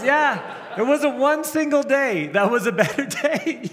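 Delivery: very high, half-shouted punchline; imitating a worked-up confession, breaking into a laugh; comic exasperated outburst. Acted punchline, laughing audience.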